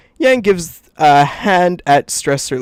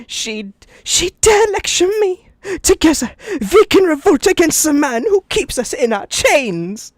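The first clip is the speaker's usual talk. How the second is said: lightly voiced character; 10 semitones higher than usual. Voicing the faunus character.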